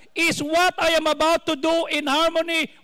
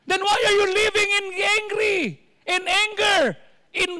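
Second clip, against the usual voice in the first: raised, higher preaching rebuke; rebuking shout; 4 semitones higher than usual. Real, not acted. Preacher scolding the angry listeners, raised voice. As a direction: call them out on their anger, voice up.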